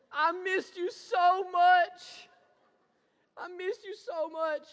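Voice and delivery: put-on high, very slow, loud tearful little girl; crying toddler. Father retelling daughter's happy tears, high crying child voice. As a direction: imitate a little girl sobbing with joy. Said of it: "She said".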